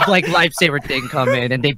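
usual speaking voice

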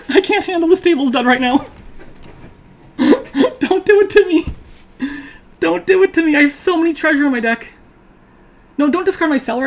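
high, laughing pleading; laughing panic. Real, not acted. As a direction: beg through laughter. Dreaded stables draw.

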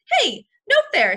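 high, loud protest. A narrator's reading. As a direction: call foul at the ring. Manager protests the penguin fight.

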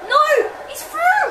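high-pitched cry of alarm. Real, not acted. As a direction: cry out as the danger breaks through. Opponent breaks through in game.

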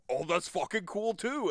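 high, drawn-out delight; enthusiastic approval. Acted. Hobbyist hearing why a fan likes something, high enthusiastic approval. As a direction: stretch it out high, openly impressed. Acting out approval of fan's answer.

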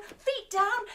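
usual speaking voice

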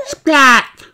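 very high, loud gleeful shout. Acted. Little George shouting about jumping in puddles, gleeful yell. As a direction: yell it with glee. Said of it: "shouts George".

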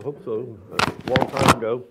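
talking while crunching chips; chewing something crunchy. Real, not acted. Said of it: "sorry about the crunch".

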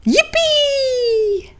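very high squeal of delight; donkey squealing yippee; joyful cheer. Acted. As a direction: cheer like a thrilled child. Donkey overjoyed.

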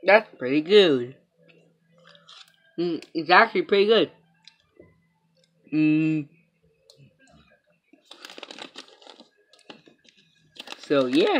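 talking while eating chocolate; muffled chewy speech; mouth full, chewing. Real, not acted. Seen 0: puffed cheeks, chewing motions; holds chocolate wrapper, bends to bite.

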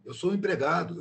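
ordinary delivery

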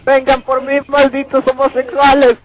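loud high taunting shout; taunting yell. Real, not acted. Taunting zombies in game.

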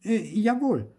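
high, loud little voice; obliging puppet. A narrator's reading. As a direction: answer obediently in a high voice. Puppet answers farmer's order. (hoch und folgsam)

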